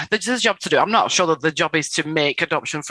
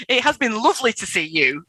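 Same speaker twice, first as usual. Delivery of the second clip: emphatic, sincere redo with higher pitch; wholehearted, higher-pitched farewell; meaning it; 4 semitones higher than usual. Shown as a demonstration. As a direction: say it like you really mean it. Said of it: "Say it like you mean it".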